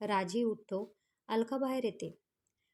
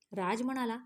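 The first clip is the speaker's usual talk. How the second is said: ordinary delivery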